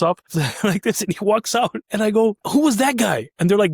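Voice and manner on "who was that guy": high-pitched, awestruck question; amazed question. Retold or imitated. Trainee to colleagues about a stranger, amazed. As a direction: ask it in astonishment, pitch lifted. Retelling his amazed reaction.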